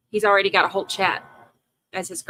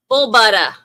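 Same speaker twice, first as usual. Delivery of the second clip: loud Boston "Bull butter", ending in "buttah"; put-on Boston accent, performed on request; 6 dB louder than usual. Shown as a demonstration. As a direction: belt it out in a mock Boston accent, R dropped. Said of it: "Say bull butter with a box".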